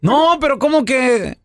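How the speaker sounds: high, taken-aback question; el feo. Acted. Poor man turned down by the devil, high puzzled voice. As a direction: squeak it in disbelief. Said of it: "grita el feo".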